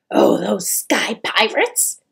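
loud, indignant grumbling troll mother. A narrator's reading. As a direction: grumble crossly and forcefully. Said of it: "she grumbled".

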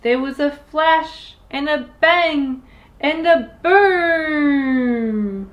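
loud, building cry to the boom; dramatic loud build. Acted. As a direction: blast it out like an explosion. Spell explodes.

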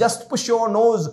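ordinary delivery

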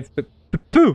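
usual speaking voice

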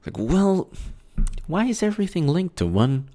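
soft, high coy question; sarcastic hush. Real, not acted. Host answering critics, soft sarcastic aside. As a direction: play dumb in a near whisper. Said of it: "sarcastic hushed reply".

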